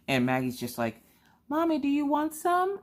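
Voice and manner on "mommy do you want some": put-on much higher, sweet small child voice; little girl voice. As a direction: offer it like an innocent little girl. Voicing young daughter Maggie.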